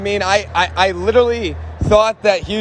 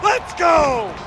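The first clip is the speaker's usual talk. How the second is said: touchdown call; 6 semitones higher than usual. Real, not acted.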